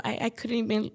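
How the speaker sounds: normal talking voice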